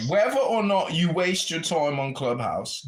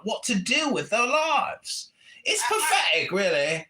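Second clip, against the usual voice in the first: mock-outraged put-on character yelling at the room; put-on comic voice. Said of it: "I guess I can stop talking like this now".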